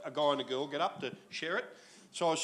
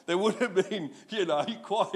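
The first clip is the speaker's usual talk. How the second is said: normal talking voice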